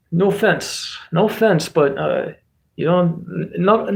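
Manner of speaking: put-on Russian accent. Streamer excusing a jab at the EU, mock Russian accent. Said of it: "this is the Russian accent version of this".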